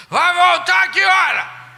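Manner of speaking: loud high barked question; gruff father. Retold or imitated. Strict father questioning his wife, loud. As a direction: demand it like a suspicious dad.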